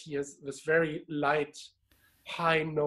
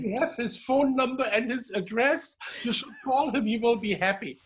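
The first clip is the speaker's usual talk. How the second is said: a lightly voiced old lady, higher and eager; old lady, encouraging; 6 semitones higher than usual. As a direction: nudge him kindly to call. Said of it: "And she said".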